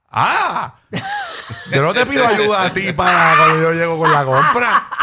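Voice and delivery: high, loud laughing mock retort; wife's retort. Wife refusing to help with groceries, loud mock retort. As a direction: snap back like the wife. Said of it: "Le digo".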